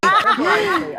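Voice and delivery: high squealing laugh through words; cracking up in agreement. Real, not acted.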